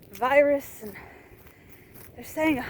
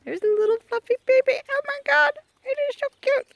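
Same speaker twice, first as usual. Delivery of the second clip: cooing; 12 semitones higher, faster than usual. Real, not acted. Cooing at baby alpaca.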